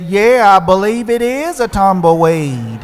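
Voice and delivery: voiced bus driver.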